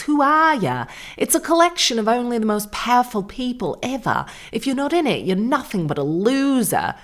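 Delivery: high, swooping Australian impression; hammed-up Australian socialite; mock Australian accent. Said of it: "She does sound Australian to me".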